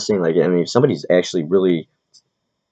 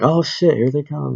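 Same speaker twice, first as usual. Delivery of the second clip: high-pitched "oh shit" reaction; retold mock alarm; 10 semitones higher, slower than usual. Reacting to the fire truck coming, mock alarm.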